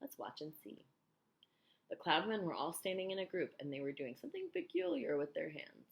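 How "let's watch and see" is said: hushed, cautious suggestion; soft, secretive reply; softly. A narrator's reading. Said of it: "James answered softly".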